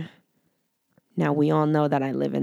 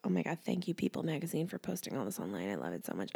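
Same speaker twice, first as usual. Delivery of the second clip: hushed; 14 dB quieter, much faster than usual. Real, not acted. Excited about the cast list.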